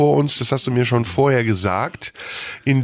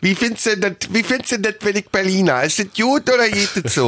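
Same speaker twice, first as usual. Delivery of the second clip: put-on Berlin accent; 7 semitones higher, faster than usual. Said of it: "Das klingt so unauthentisch".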